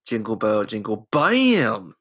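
drawn-out, loud catchphrase; overexcited Imagineer. Retold or imitated. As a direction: stretch the final BAM into a silly shout. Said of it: "obviously the overexcited Imagineer".

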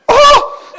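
very high, loud yelp; Peter's loud startled oh; startled shout. Retold or imitated. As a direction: cry out in sudden shock. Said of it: "He goes, shout".

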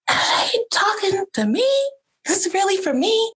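high-pitched, disbelieving questions; shocked. Retold or imitated. Reacting to the gift message, shocked. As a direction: re-enact stunned disbelief, pitch way up. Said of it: "I just was so shocked".

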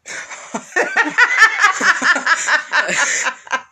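loud, high, drawn-out cackle; big pretend laugh at a silent joke; put-on laughing fit. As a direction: burst into long, exaggerated laughter. Showing a silent joke was funny.

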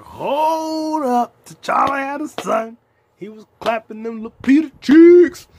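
stunned fan yelling in the theater; shocked yell. Retold or imitated. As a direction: shout it in gleeful disbelief. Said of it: "I was like".

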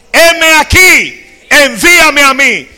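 "Heme aquí" cried out very loud and high, drawn out slowly; "envíame a mí" belted high and loud from the pulpit; proclaimed. Acted. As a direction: shout "Heme aquí, envíame a mí" as a soaring declaration. Isaiah's answer, preached climax.

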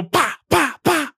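rising pa pa popping sounds; popping pa noises; imitated bangs. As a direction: mimic small firework pops. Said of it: "and it's go".

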